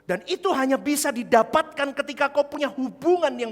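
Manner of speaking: loud high preacher's cry; fiery sermon shout; preaching at full force. Real, not acted. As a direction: thunder it out to the church. Preacher proclaims.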